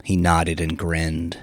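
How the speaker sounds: usual speaking voice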